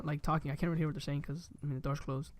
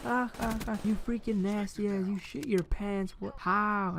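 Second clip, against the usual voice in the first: higher, slow mock laughing taunt; imitated laughing sister; 3 semitones higher, slower than usual. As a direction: mimic a sister teasing through laughter. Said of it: "she's still laughing".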